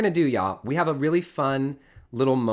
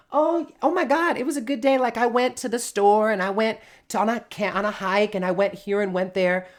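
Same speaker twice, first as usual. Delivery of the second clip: much higher, louder mock gushing; hammed-up excited rambling about the day; gushing small talk; 7 semitones higher, 4 dB louder than usual. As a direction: bubble over like an oversharing friend. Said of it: "we end up saying".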